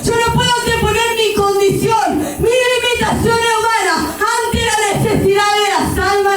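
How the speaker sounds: impassioned plea, climbing high and insistent; retold tearful prayer. Woman retelling her repentance to God, impassioned tearful pleading. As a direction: cry out to God, building as you repeat. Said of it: "Comencé a llorar y a decirle".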